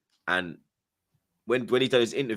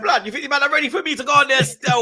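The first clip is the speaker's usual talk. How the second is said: high, loud retold outburst; indignant refusal; 13 semitones higher, 6 dB louder than usual. Telling Joe he won't go on the channel, indignant. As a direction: fire it off in disbelief, high-pitched.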